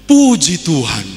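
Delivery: loud, relieved praise; acted grateful cry. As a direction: exclaim it loudly, thankful to be saved. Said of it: "Pendeta bersyukur luar biasa".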